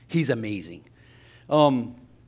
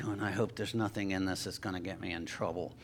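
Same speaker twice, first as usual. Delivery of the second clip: low, hushed thought to himself; worried aside; 7 semitones lower, 12 dB quieter, faster than usual. Retold or imitated. Traveler privately worrying about a stranger's package, worried aside.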